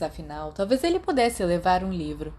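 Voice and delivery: ordinary delivery, nothing marked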